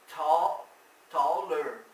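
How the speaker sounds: "tall", then "taller", each loud and separate; loud, deliberate model of "tall, taller"; emphatic teaching demo. Shown as a demonstration. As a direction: punch out "tall", then stretch "taller" with its ending. Teacher builds comparative from adjective.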